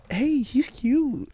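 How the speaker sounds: high, drawn-out flirty imitation; mock flirty. Imitates man's flirty remark at party.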